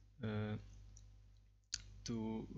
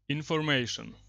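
louder, clearly spoken single word; projecting a command at the microphone; 4 semitones higher, 12 dB louder than usual. Real, not acted. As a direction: call it out crisply so the software picks it up. Commands spoken to speech recognition.

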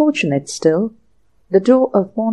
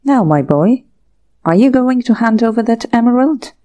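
stern demand; 4 semitones higher, 4 dB louder than usual. Acted. Inspector presses James for emerald.

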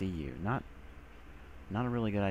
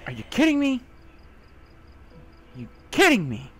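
loud frustrated yell; exasperated yell; 10 semitones higher, 8 dB louder than usual. Real, not acted. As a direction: shout in disbelief.

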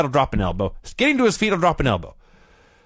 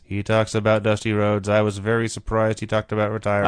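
imitating Crockett; 5 semitones lower than usual. Host mimics Crockett's monotone for his cohost, low and dull. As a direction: drone it like a bored promoter. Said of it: "He speaks like this".

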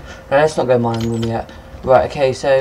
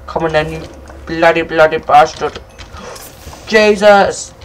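loud, high cursing at a mob; fighting frustration; 5 semitones higher, 5 dB louder than usual. Real, not acted. Gamer cursing a mob during a fight, loud and high. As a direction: curse at it mid-fight.

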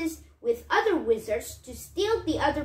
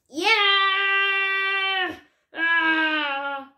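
high loud mock scream breaking into a cry; hammed-up pained wizard scream; 6 semitones higher, 4 dB louder than usual. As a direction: yelp as the beard burns. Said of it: "Wiglaf screamed".

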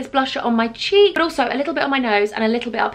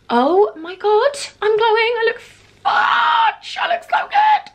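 much higher, louder, slow delighted squeal; ecstatic shout; 11 semitones higher, 3 dB louder, slower than usual. Real, not acted. Vlogger thrilled at her finished makeup, squealing. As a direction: gush over your own glowing skin.

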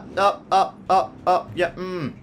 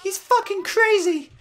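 panicked bystander. Pretend. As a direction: scream like a terrified witness. Fake panicked bystander call.

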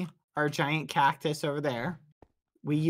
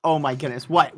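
normal talking voice

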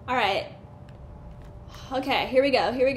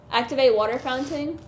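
ordinary delivery

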